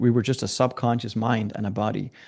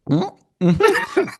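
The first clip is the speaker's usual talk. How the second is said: nervous laugh; 11 semitones higher than usual. Retold or imitated. Nervous reply backstage, laughing.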